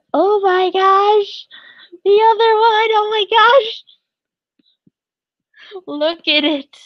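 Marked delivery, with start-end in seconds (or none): 0.1-1.5 high-pitched, drawn-out delighted squeal; slow high squeal of delight at the art; excited squeal. Real, not acted. Overjoyed at fan art gift. 2.0-3.8 excited squeal. Real, not acted. Overjoyed at second art piece.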